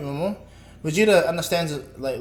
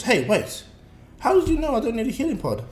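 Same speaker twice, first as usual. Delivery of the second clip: higher, puzzled challenge; sudden realization; 8 semitones higher, 4 dB louder than usual. Acted. Goku questions Bulma about the healing pod, puzzled. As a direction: catch on mid-thought and question it.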